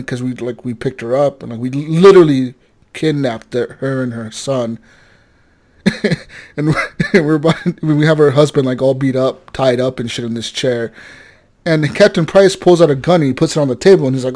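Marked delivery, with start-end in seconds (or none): none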